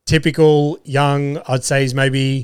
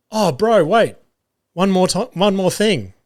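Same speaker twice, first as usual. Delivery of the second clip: higher-pitched call after the car; 6 semitones higher than usual. Retold or imitated. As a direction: catch him before he drives off. KFC worker calling after departing car.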